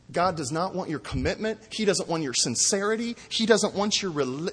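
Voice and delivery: usual speaking voice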